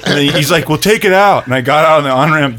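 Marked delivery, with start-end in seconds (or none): 0.7-1.4 higher, louder mock command; mock exasperated order. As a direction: bark it like obvious advice. Retold friend barking advice.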